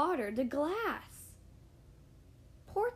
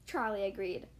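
ordinary delivery, nothing marked

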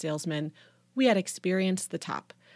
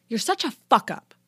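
higher, louder venomous retort; spitting anger; 5 semitones higher, 6 dB louder than usual. A narrator's reading. Daughter lashes out at dad, spitting anger. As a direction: spit out the insult. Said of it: "I spat".

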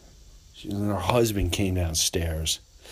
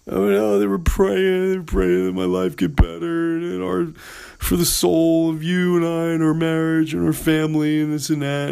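quoted wife; 6 semitones higher than usual. Retold or imitated. Wife explaining her in-laws' prayer.

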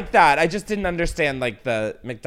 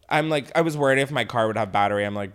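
normal talking voice

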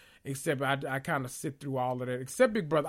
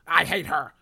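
loud again; 8 dB louder than usual. Real, not acted. Ranting to viewers about the houseguest, still loud. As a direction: still loud "I hate her", a bit less. Escalating rant about a cast member.

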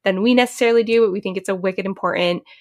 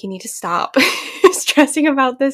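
scolding friend; 5 semitones higher, 3 dB louder than usual. Retold or imitated. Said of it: "she's gonna be like".

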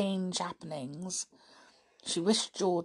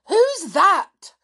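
loud, irritable bark; irritable shout; 13 semitones higher, 11 dB louder than usual. A narrator's reading. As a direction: snap it out, annoyed and loud. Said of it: "came his stepfather's irritable voice".

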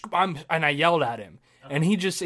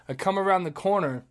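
normal talking voice

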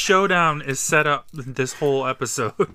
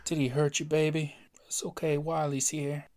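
tender coo; 7 dB quieter than usual. Retold or imitated. Voicing Wiley to hockey stick.

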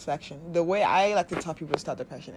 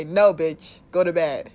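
louder, firm no-nonsense command; scolding the body; 8 dB louder, slower than usual. Pretend. You telling your body to go to bed, loud and firm. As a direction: bark it like a parent sending a kid to bed.

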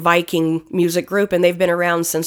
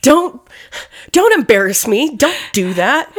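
mock scolding; 5 semitones higher, 3 dB louder than usual. Scolding her own mouth.

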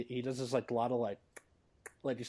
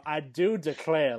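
slow, loud hammed-up Southern drawl; southern accent; 3 semitones higher, 9 dB louder, slower than usual. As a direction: draw it out like a showy Southerner. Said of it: "like, in a southern accent".

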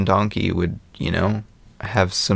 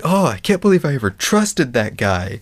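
put-on whiny teen; 7 semitones higher than usual. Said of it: "J T is just like".

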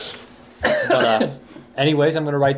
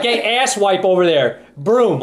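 higher, slower gruff order; bossy director; 6 semitones higher, 4 dB louder, slower than usual. Retold or imitated. Director ordering the assistant to sweep, mock gruff. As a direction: bark at the lowly assistant. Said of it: "He'd be like".

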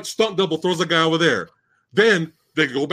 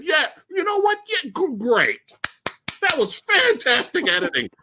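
mock approval; 8 semitones higher than usual. Mocks editor approving the bad cut.

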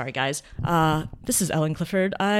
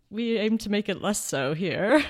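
normal talking voice

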